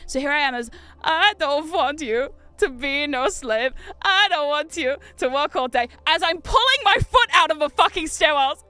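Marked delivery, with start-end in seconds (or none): 1.0-5.9 put-on slow sultry Transylvanian singing accent. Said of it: "in a Transylvanian accent". 6.1-8.6 loud. Real, not acted. Yanking foot from stair.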